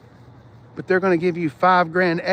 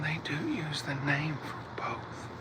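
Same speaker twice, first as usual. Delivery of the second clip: soft whispered aside; 16 dB quieter, slower than usual. Real, not acted. Telling chat about the name Blair, whispered. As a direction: slip it in under your breath.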